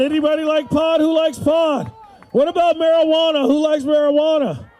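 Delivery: loud, high crowd-pumping shouts; booming motivational speech. Real, not acted. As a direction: whip the crowd into noise. Comedian works up the crowd.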